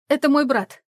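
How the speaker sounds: high, loud, rushed blurt. A narrator's reading. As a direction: blurt it all out at once. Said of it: "выпалила".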